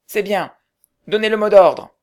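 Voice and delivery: high, loud demand; loud sentry command. Acted. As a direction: bark for the password.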